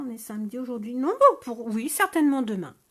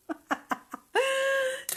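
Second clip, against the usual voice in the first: burst of laughter. Real, not acted. Laughing at viewer's pig joke.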